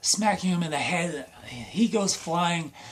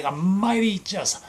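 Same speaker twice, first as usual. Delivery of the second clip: usual speaking voice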